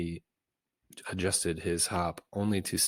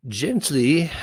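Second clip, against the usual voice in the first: sudden high shriek, much louder; 9 semitones higher, 11 dB louder than usual. Acted. Fussy Cineas shrieking to Berwick grabbing his proclamation. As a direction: cry out sharply in alarm. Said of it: "Cineas shrieked".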